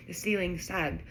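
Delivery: plain reading voice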